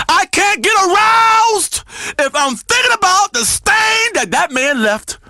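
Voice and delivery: high, slowed, anguished rant; worked-up outrage. Acted. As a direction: wail the grievance, stretching the words. Worked-up rant about the stain.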